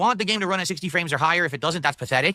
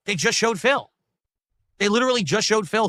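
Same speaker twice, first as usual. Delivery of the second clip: excited rebuttal; 5 semitones higher than usual. Real, not acted. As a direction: hammer the proof home.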